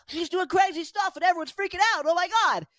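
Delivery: high, rushed freak-out; mock panic. Host mimicking everyone freaking out, high and frantic. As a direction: race through it in hammed-up alarm.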